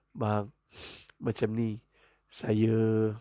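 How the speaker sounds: low, halting, tentative start; acted timid approach, long pauses; hesitant wife. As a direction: stall nervously before confessing. Wife nervously broaching subject to husband.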